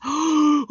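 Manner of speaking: excited gasp. Acted. Thrilled at figuring it out.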